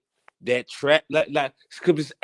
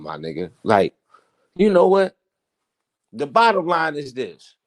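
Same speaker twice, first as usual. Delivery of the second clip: normal talking voice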